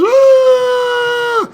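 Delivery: high, loud imitated bawl; mock wail. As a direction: wail like an overdramatic little sister. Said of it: "my sister goes".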